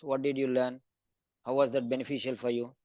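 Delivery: ordinary delivery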